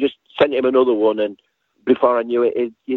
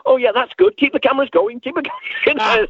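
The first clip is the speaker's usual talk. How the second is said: high, hammed-up glee; mock eager; 14 semitones higher than usual. Telling the crew to keep filming Dave, high mock glee. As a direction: gleefully urge the crew to keep rolling. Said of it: "Now I, I went".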